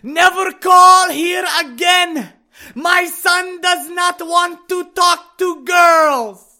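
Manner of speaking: put-on high, loud yell in an Arabic accent; yelling mother. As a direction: shout it down the phone like an outraged mother. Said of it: "embarrass me as she yells".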